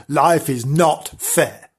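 Life is not fair dragged out slowly, each word weighed; slow solemn verdict. Acted. Narrator to Frank, slow solemn mock sermon.

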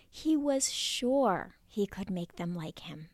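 ordinary delivery

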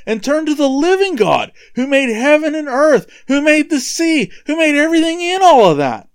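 high, urgent acted appeal; imitating apostles calling out in protest; shouting to crowd. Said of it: "the crowd shouting".

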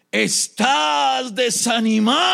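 slow, high, drawn-out shout; forceful demon. Acted. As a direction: bellow it, stretching every word. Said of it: "tomó fuerza este demonio".

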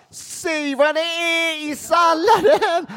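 high, slow, demanding yell; hammed-up bellow for the answer; Peter Apelgren shouting. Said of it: "Peter Apelgren är med, och han bara".

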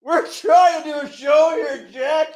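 high, loud, slow exasperated shout; mock scolding. Echoing the co-host's rebuke of Jack, mock exasperated shout. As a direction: bark it like a fed-up boss. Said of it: "That was like fucking, what's his name on The Office?".

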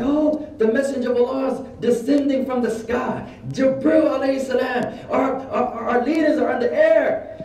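high, mock-awestruck proclamation; excited high cry, imitating a zealot; imitated ecstatic believer. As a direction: proclaim a vision from the sky, hammed up. Acts ecstatic brother seeing descending angels.

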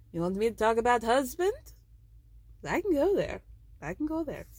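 mock Russian-sounding threat; imitated Russian accent. [name] threatening talk of husband, mock Russian accent.

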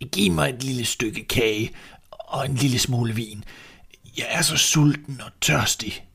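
put-on pleading, frail old beggar; begging old man. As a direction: ask for food like a tired old man. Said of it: "som sagde goddag og bad".

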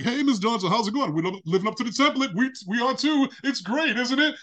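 mock the neighbors' forced smile; fake-cheery neighbor.

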